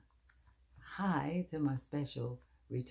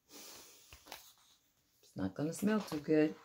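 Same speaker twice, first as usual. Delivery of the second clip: knowing tease; 3 semitones higher, much slower than usual. Acted. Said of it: "teasing children about skunk".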